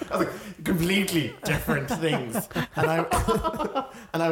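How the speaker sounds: high, loud words through a laugh; real laughing while talking. Real, not acted. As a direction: let laughter break into the line. Laughing at the retold mix-up.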